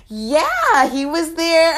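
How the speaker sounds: high, loud burst of recognition; imitated excited child. Girl confirming Michael Myers was there, excited imitation. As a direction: pounce on it like a thrilled kid.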